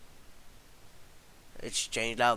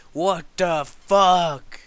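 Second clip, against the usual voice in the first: slow loud what the fuck; drawn-out disbelief; 4 semitones higher, 8 dB louder, much slower than usual. Real, not acted. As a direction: stretch every word in shock. Game keeps trapping him.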